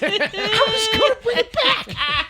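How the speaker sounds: high, slow, drawn-out plea; hammed-up bit part. Actor showing roles he reads for, hammed-up plea. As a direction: overact a pleading audition line, stretched high. Acting a stock audition role.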